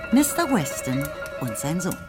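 usual speaking voice

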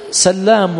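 سلام with a long doubled L. Shown as a demonstration. Teacher listing names in six books, L doubled in سلام. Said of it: "مشدد".

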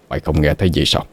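low, hushed frightened uncle; faint, fearful undertone; feeble trembling murmur. A narrator's reading. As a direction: murmur it low, trying not to be heard. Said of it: "Nghe cái giọng thều thào run rẩy của chú Hùng".

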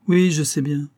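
plus haut et plus fort; interruption impatiente. A narrator's reading. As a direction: couper la parole vivement. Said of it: "interrompit Rose". (impatience vive)

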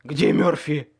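loud, rasped question; croaked, forceful demand; hoarse demand. A narrator's reading. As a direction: rasp it out urgently. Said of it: "прохрипел я".

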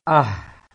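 "Ah" loud with a breathy h from low in the throat. Shown as a demonstration. Teacher demonstrating round ha, loud. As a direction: breathe the h out from deep down. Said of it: "Ha bulat".

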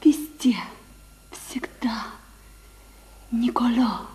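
whisper. Acted. Intimate scene with Paganini.

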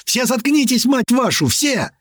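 high, strained furious yell; frenzied shouting. A narrator's reading. Navigator silencing the panicking crew, frenzied shouting. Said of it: "Он буквально зашёлся в крике".

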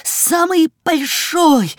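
высоко и протяжно, захлёбываясь от восторга; мальчишеский восторженный возглас, очень высоко; взволнованный крик. A narrator's reading. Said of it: "захлёбываясь от волнения, крикнул Жорик". (взволнованно выкрикнуть)

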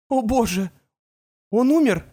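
high frightened cry; panicked mother. A narrator's reading. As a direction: gasp it out in fear for her son. Mother fears son dead.

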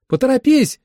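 high-pitched, loud hurry-up cry; shouted urging. Acted. Holly yells to him through the rift, high shout. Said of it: "крикнула Холли".

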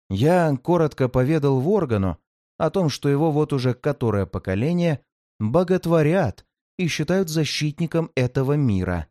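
plain reading voice

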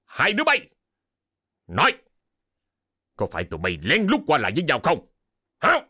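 furious master demanding answers; sharp, high bursts of interrogation; angry shout. A narrator's reading. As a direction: roar at the two culprits. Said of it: "ông đã đập bàn la lớn".

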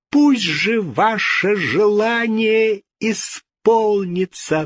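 slow, high, biting sarcasm; bitter irony. A narrator's reading. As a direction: wish it on them with bitter mockery. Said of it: "с горькой иронией".

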